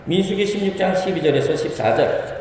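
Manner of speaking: ordinary delivery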